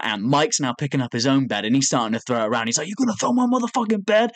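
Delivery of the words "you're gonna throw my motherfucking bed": high-pitched mock outrage; imitated screaming. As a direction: demand it in a high, put-on angry voice. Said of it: "they're all fucking screaming".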